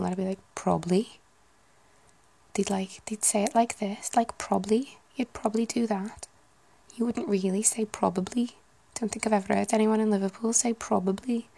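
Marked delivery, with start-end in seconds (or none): 0.5-1.2 punchy Scouse, rising at the end; Liverpool accent. Shown as a demonstration. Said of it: "so again in Liverpool". 2.5-11.5 lilting, lively Scouse; Liverpool accent. Shown as a demonstration. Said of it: "why I did a whole sentence".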